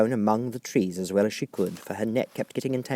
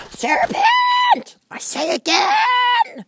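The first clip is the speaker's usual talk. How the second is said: very high, loud bird cry; high loud pigeon shout; shrieking; 7 dB louder, much slower than usual. A narrator's reading. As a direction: shriek it once more. Pigeon keeps shrieking at Alice.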